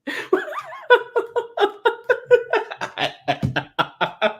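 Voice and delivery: long high-pitched laughing fit; high helpless laughter. Real, not acted. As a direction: lose it completely, gasping laughter. Uncontrollable laughter at clip.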